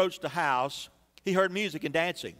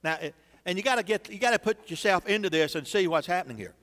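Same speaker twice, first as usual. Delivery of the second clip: ordinary delivery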